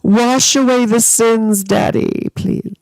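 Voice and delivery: high, slow mock-pious plea; mock pleading prayer. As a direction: ham up a begging prayer. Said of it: "I'm like".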